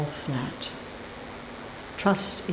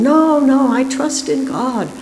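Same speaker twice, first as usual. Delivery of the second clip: much higher, louder insistent no; man refusing; 8 semitones higher, 4 dB louder than usual. A narrator's reading. Man refusing the helicopter line, high insistent refusal. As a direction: insist on trusting God. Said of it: "the man replies again".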